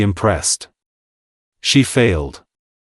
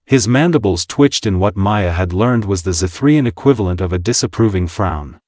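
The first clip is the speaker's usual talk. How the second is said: ordinary delivery, nothing marked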